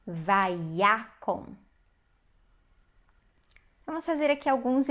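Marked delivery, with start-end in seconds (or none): 0.1-1.6 "vaiakon" slowly as va-ya-kon; demonstrated reading. Shown as a demonstration. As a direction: sound out "vaiakon", A on ya and O in the last syllable. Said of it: "a última sílaba só pode ser uma sílaba átona". ("vaiakon" devagar, separando as sílabas)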